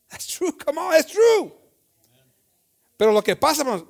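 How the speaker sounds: high, loud preaching; fervent preaching. Acted. Preacher urging courage against gossip, loud fervent preaching. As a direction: urge them with fire.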